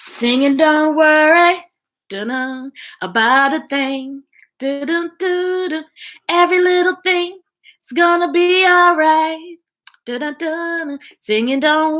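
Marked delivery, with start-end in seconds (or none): none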